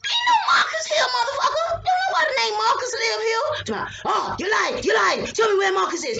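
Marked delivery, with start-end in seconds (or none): 0.0-3.8 mother yelling at a burglar in her bedroom; furious yell. Retold or imitated. Yelling at intruder, retold. 4.0-6.2 mock intruder demand. Intruder insisting, imitated.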